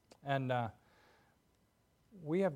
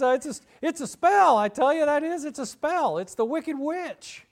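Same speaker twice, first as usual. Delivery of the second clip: high, loud, fretting voice that slows at the end; panicky scarecrow; 13 semitones higher, 11 dB louder than usual. Retold or imitated. Scarecrow blaming the wicked witch, high and alarmed. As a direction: insist it's a spell in a frightened squeak. Scarecrow frets about a spell.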